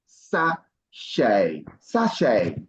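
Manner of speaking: "sachet" said slowly as "sa-SHAY", silent t; slow model, sachet. Shown as a demonstration. Teacher models sachet slowly for the learners. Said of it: "The T is silent".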